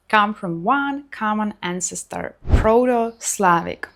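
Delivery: ordinary talk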